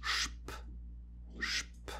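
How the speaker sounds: isolated, quiet sh plus P; shp for SP. Shown as a demonstration. German teacher showing S and P at word start, lone shp. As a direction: sound out SP as shp. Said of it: "this combination is pronounced S C H, P".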